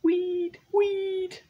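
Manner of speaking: tiny high-pitched squeak; very high, loud little call; squeaky high call. Acted. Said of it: "Little Weed called out".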